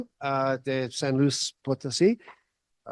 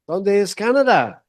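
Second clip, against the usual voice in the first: American accent Spanish; 5 semitones higher, 5 dB louder than usual. Real, not acted. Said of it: "mi español es no perfecto".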